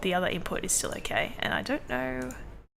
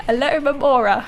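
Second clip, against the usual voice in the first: Alomomola through laughter, higher; laughing; 6 semitones higher, 8 dB louder than usual. Pretend. Echoing the silly take.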